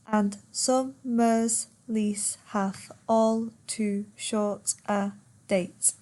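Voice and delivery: slow, syllable-by-syllable verse reading; per suku kata. Shown as a demonstration. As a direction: count out the line, one beat per syllable. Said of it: "ten syllables".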